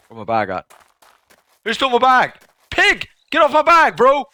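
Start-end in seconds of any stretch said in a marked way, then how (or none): 1.6-4.2 high, loud indignant shouting; outraged yelling. Real, not acted. Gamer yelling at a pig on his bag, high outraged shout. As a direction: yell at the thief to drop it.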